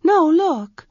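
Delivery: put-on a young priestess pressing for an answer; Arha's voice. Young priestess Arha urgently asks her servant for a room.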